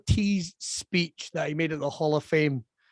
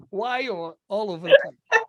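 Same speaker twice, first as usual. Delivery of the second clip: ordinary talk, nothing marked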